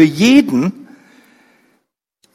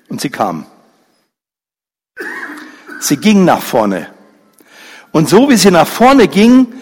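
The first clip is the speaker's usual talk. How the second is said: normal talking voice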